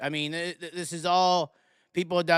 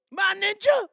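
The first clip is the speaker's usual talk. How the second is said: mock yell; 12 semitones higher than usual. Mock shout at viewers.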